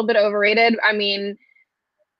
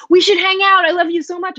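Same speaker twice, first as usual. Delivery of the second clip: higher-pitched imitation of gushy acquaintances; mock gushing; 8 semitones higher, 4 dB louder than usual. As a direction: gush like an instant best friend, mockingly. Mimicking gushy new acquaintances.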